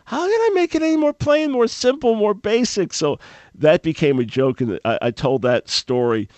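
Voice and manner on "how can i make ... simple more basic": much higher, strained disbelieving squeak; preacher baffled by the call, strained squeak; exasperated inner thought. Retold or imitated. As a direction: sputter in disbelief. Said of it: "I said to myself".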